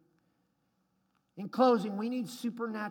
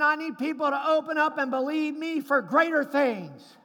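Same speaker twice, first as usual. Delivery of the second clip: louder, higher booming divine voice; voice of God; 7 semitones higher, 9 dB louder than usual. Acted. Preacher voicing God to the congregation, booming. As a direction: proclaim it as God himself. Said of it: "God is saying".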